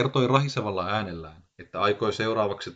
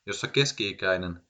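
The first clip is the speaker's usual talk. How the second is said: ordinary delivery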